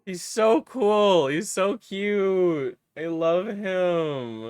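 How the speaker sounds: high, slow, doting coo; gushing. Real, not acted. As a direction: melt over a cute pet. Gushing over the parrot.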